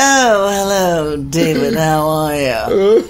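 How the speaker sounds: slow greeting with daggers in it; icy mock politeness. Turning round to greet the traitor, mock-icy. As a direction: drawl it out with venomous fake courtesy. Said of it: "daggers are coming out of my".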